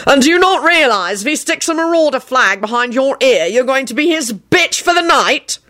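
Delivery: high-pitched indignant lecture; roared scolding. A narrator's reading. Boss spelling out the flag's meaning to Neville, exasperated. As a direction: press on incredulously, pitch held up. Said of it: "Kingsley roared".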